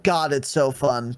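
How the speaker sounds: ordinary talk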